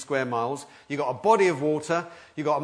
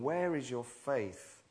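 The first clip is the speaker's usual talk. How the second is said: soft question; 7 dB quieter, slower than usual. Shown as a demonstration. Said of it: "quiet repeated question".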